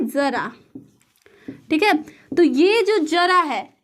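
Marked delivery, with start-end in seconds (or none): 0.1-0.5 ज़रा with a buzzing z, not j; ज़रा, the z sound marked by the nukta. Shown as a demonstration. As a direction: say ज़रा with a soft z at the start. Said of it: "एक होता है".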